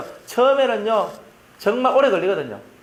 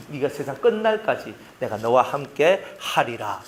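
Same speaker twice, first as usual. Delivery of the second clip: slow, weighty scriptural cadence; slow solemn promise; 5 semitones lower, slower than usual. Acted. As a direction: lean on 끝날까지 and draw it out.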